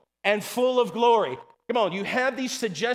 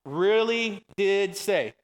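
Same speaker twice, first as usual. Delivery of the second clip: very slow, word-by-word preacher's question; say-along question; much slower than usual. Shown as a demonstration. Preacher leads the congregation in the serpent's question, slow and drawn out. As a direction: stretch each word so the room can join in. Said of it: "come on, say that with me".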